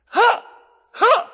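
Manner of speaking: short, high, loud imitated kiai; explosive mock karate grunts; martial arts yell. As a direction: shout each one like a strike.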